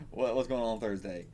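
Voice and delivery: ordinary talk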